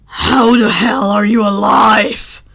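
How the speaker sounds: loud, slow, rough yell; demanding shout. Acted. Bakugo shouting at Denki in shock, demanding. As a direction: bellow it in disbelief. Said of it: "Bakugo almost demanded".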